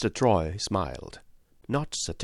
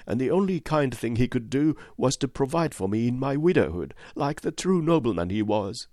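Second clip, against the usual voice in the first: higher, devoted servant's voice; a loyal widow praising her lord; humble, heartfelt widow; earnest widow; 7 semitones higher, 3 dB louder than usual. A narrator's reading.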